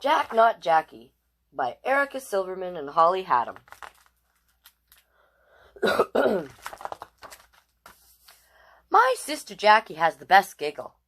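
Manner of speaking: plain reading voice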